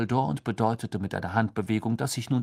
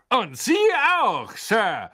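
loud, high, hearty; tipsy old knight bellowing; booming knight; 10 semitones higher, 5 dB louder, slower than usual. A narrator's reading. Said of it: "dröhnte Sir Cadogan". (dröhnend)

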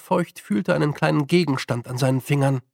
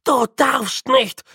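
pleading; 9 semitones higher, 4 dB louder, slower than usual. A narrator's reading. Grütze pleads.

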